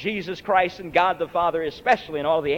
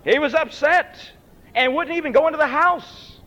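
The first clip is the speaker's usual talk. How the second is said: raised preaching; 9 semitones higher, 3 dB louder than usual. Acted. Preacher voicing upset brother.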